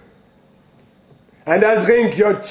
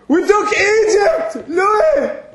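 shouting; 12 semitones higher, slower than usual. Acted. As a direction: shout it out proudly. Reminiscing with old friend Louis.

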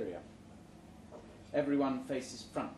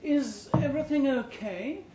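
high, louder anxious question; a chirpy boss turning concerned; worried HR boss; 10 semitones higher, 7 dB louder than usual. A narrator's reading. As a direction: fuss over him, pitched up. HR head worried.

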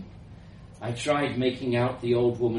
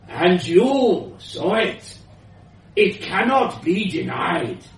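put-on high, loud, slow insistence with an accent; elderly foreign woman; 6 semitones higher, 7 dB louder than usual. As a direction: press the point as a forceful old scientist. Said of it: "elderly woman's voice, powerful and slightly foreign".

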